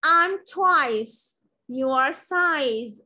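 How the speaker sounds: I am twice your size word by word; teacher models sentence four for students; very slow model reading. Shown as a demonstration. Teacher models very slowly.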